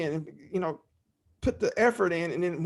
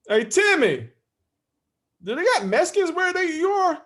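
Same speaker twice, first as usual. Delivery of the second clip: much higher, louder sly question; loud dad's loaded question; 12 semitones higher, 5 dB louder than usual. Retold or imitated. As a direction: fish for the answer with a sly high pitch.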